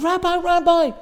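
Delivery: high, loud urgent call. Retold or imitated. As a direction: shout after him across the crowd. Merchant calling out to rabbi.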